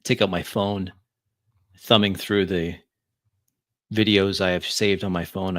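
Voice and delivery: usual speaking voice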